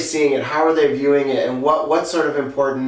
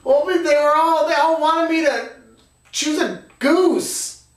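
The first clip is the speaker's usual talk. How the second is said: very high, put-on child voice; upset kid; 13 semitones higher, slower than usual. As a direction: imitate a child explaining, high and drawn out at the end. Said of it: "And he said".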